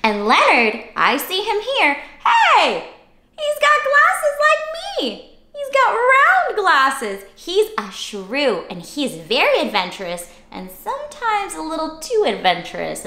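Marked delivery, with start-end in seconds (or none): 2.2-7.2 high, bright surprise at the picture; delighted squeal. Real, not acted. Host spotting glasses like hers, delighted. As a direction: squeal at a shared detail.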